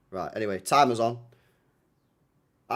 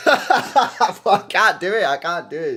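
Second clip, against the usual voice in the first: loud, high words bursting through laughter; laughing too hard to continue; laughing helplessly; 8 semitones higher, 11 dB louder, slower than usual. Real, not acted. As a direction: give up in a fit of laughs. Breaks up attempting the accent.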